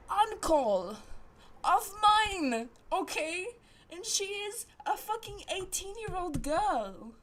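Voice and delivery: scandalized, pitched high; outraged. Real, not acted. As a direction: hit each phrase in loud disbelief. Outraged reveal of uncle in story.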